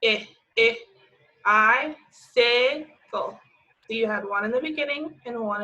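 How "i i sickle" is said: "I, I, sickle" with the I punched louder; split into syllables. Shown as a demonstration. Teacher splitting "Icicle" into parts for kids. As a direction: break the word apart to show the sound. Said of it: "which one was in the middle".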